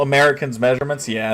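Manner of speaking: ordinary delivery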